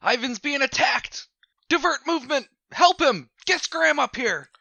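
urgent high whisper-shout; hissed urgent call for help; whisper yelling. Acted. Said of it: "I whisper yell at Autumn".

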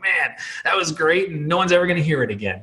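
high, pumped-up self-congratulation; pleased with himself. Retold or imitated. Pastor mocking his own after-sermon glow, excited high voice. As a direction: play your own post-sermon high. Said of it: "you get off stage and like".

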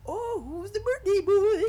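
high, drawn-out clown voice; loud, squealing clown greeting a kid. Retold or imitated. Clown greets the birthday kid, high clown voice. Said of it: "and the clown".

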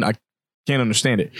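normal talking voice

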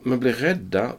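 ordinary talk, nothing marked

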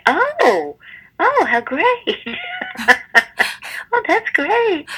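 very high-pitched mock delight; hammed-up, high-pitched "oh, how great"; gushing delight. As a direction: squeal it sweetly, far above your normal voice. Acted delighted reaction to bad news.

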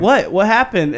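imitating confusion. Rapper imitating his puzzled friend in the studio, high mock confusion. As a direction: play the friend's confused reaction.

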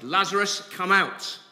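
loud, high call into the tomb; shouted command. A narrator's reading. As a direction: call Lazarus out with full voice. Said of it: "And then he shouted".

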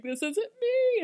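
high, drawn-out distraught cry; imitated panicked denial; mid-breakdown wail. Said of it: "her whole body is shaking".

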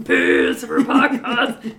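slow, laughing monster growl; Cookie Monster voice. Pretend. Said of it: "or you just do that Cookie Monster voice".

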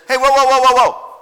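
high, loud frantic cry of a cornered bear; panicked bear. Acted. Bear begging the hunter not to shoot, high and loud. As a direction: yelp it out like a bear staring down a gun. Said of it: "And the bear says".

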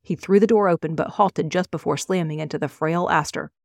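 plain reading voice

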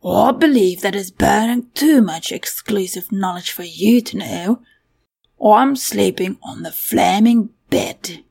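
stressed words punched in a put-on voice; grand declaration from an indignant creature; glaring, self-important creature; haughty creature voice. Story figure speaking.

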